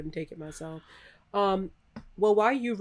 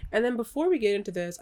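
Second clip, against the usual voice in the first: usual speaking voice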